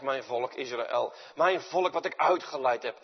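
usual speaking voice

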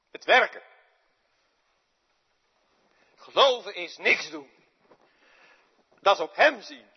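loud, high, impassioned outcry; Peter's anguish. Acted. Preacher giving voice to angry Peter, high loud outcry. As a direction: pour out Peter's frustration at full preaching force.

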